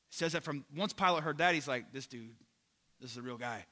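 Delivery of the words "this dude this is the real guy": low, very soft retold realization; hushed aside. Pilate on hearing Jesus answer, hushed aside. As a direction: drop right down to a quiet, understated remark. Pilate's awed reaction, jokingly paraphrased.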